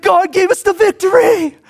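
high, weepy imitation of the quarterback's words; choked-up mock triumph; high crying voice, mocking; mock tearful. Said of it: "And then he's like".

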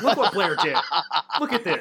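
high, loud, through laughter; mock tattling, cracking up; laughing mock outrage. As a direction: playfully show it off while laughing. Mock showing off the question, laughing.

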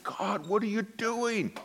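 pleading with God in dismay; exasperated plea. Retold or imitated. Pastor retelling his silent prayer at the disaster, exasperated plea.

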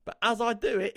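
ordinary delivery

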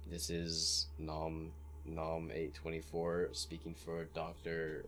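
slow, soft formal message. Acted. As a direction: read it out slowly like a careful message. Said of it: "or I'm gonna say".

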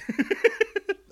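loud squealing laugh; high burst of laughter. Real, not acted. Laughing at dinosaur speak.

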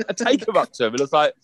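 ordinary delivery

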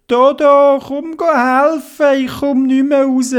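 put-on high, loud little boy's call for help; Theobald calling for help. Boy stuck in the mud calls his friend, high and loud.

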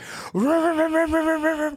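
high sustained revving noise; imitated car engine. Retelling a drift scene from outside the car, imitated engine revving. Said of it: "on the outside, it's like".

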